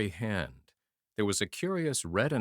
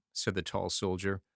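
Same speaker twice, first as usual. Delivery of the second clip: ordinary delivery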